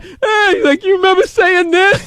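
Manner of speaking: laughing lawyer, mocked. Lawyer laughing at his client's police report, mock-amused. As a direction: imitate someone tickled by what they read. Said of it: "laughing at the police report".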